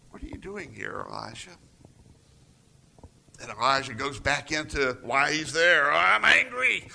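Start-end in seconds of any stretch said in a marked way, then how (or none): 0.1-1.6 hushed, softer question; small still voice. Retold or imitated. The still voice of the Lord asks Elijah, hushed. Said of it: "small, still voice of the Lord". 5.9-6.9 higher and louder, blurted out; spouting. Retold or imitated. Elijah blurting his anger to God again, higher and louder. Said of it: "he spouts the exact same thing".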